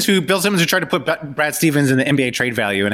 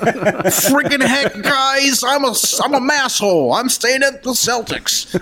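high, dorky mock protest; Indiana nerd; 10 semitones higher, slower than usual. Coach insisting he stays at the Celtics, high nerdy voice. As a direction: put on a squeaky, wholesome nerd voice. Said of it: "Little Indiana nerd".

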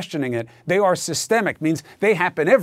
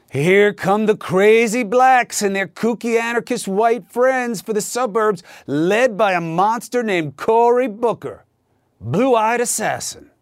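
sarcastic fear-mongering; 5 semitones higher than usual. Retold or imitated. Host mocking the president's fear tactics, sarcastic ranting parody. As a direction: ham up the scare rhetoric lightly, dripping sarcasm.

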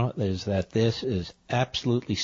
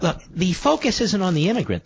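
ordinary talk, nothing marked

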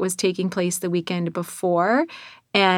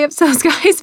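laughing; 8 semitones higher, 5 dB louder than usual. Real, not acted.

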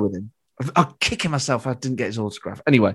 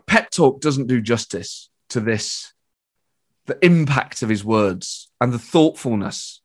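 ordinary talk, nothing marked

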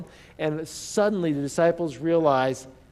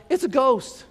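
high, loud cry of alarm; frightened cry; 9 semitones higher, 5 dB louder, slower than usual. Acted. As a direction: cry it out in sudden terror. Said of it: "they cried out".

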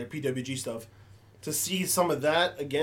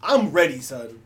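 hyped up; 3 semitones higher, 7 dB louder than usual. Real, not acted. Hyped for the rest of Raw.

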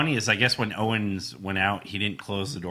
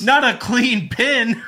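loud mock commentator; 12 semitones higher, 8 dB louder, slower than usual.

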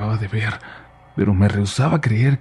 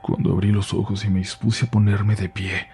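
ordinary delivery

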